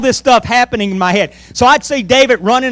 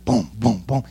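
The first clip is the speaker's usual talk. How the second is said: low, soft drum-like booms; imitated movie score; 11 semitones lower, 9 dB quieter than usual. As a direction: voice the soundtrack's deep booms. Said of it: "there'd be music playing".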